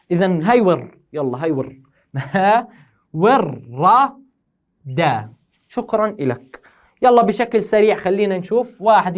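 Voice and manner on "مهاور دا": "مهاور. دا" drawn out with gaps between syllables; syllable-by-syllable pronunciation. Shown as a demonstration. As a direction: sound out "مهاور. دا" very slowly, piece by piece. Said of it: "كيف بدها تصير نطقها". (نطق "مهاور. دا" مقطعًا مقطعًا ببطء)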